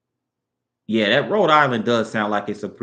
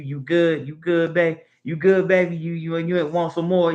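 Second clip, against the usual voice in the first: higher-pitched imitation of a caring Southern woman; imitated Southern sweetheart, pitched up; doting Southern woman; 7 semitones higher than usual. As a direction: lightly act out a Southern woman fussing over her man. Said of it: "you know, something like that".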